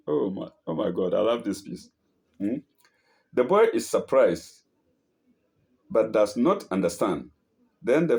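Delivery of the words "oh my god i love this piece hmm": ordinary delivery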